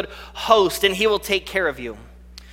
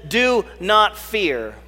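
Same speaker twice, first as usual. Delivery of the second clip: slow, drawn-out command; much slower than usual. Acted. Jesus's words, drawn out.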